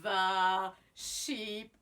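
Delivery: put-on lively sing-song storytelling for kids; reading to children. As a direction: tell it like a picture book to a class. Teacher reads story to children.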